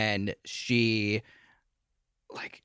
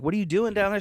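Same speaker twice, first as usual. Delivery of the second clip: higher, lightly voiced question; mildly puzzled, pitched-up quote; puzzled man calling down; 8 semitones higher, faster than usual. Acting out Keith's question.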